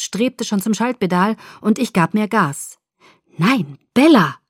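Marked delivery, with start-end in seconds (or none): 3.4-4.4 slow, loud angry warning; angry call. A narrator's reading. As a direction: warn her off sharply, slowly and loud. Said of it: "rief mir die honigsüße Stimme wütend".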